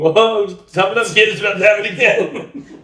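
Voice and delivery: high-pitched taunt through laughter; hammed-up taunt breaking into giggles; laughing mock bully. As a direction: act out the gleeful bully, cracking up.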